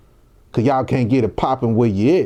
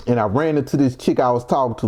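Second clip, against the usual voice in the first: usual speaking voice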